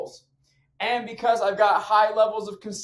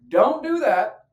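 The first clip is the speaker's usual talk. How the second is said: stern parent warning; 5 semitones higher, 7 dB louder, slower than usual. Acted. Acts parent warning child at outlet.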